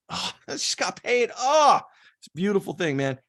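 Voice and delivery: high, laughing delight at getting paid; mock giddy payday joy; overjoyed. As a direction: gush like the paycheck just landed.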